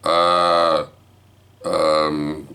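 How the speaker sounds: long, held filler sounds; stumbling for words. Retold or imitated. Grandpa questioned by wife, stalling. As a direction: stall with nothing to say. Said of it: "I'm stumbling over my words".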